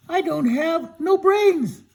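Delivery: high, slow silly scarecrow; storyteller voicing a slow, high scarecrow. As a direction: stretch it out way up high as the dim scarecrow. Scarecrow talks to Dorothy.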